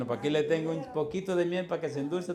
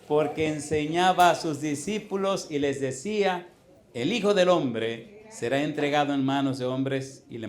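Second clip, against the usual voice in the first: preaching scripture aloud; 5 dB louder than usual. Acted. Scripture reading, louder.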